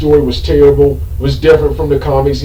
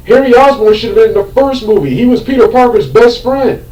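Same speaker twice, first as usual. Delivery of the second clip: higher, raised angry insistence; indignant rant, voice still pitched up; heated rant; 6 semitones higher than usual. Real, not acted. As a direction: keep the indignation high. Ranting continues after outburst.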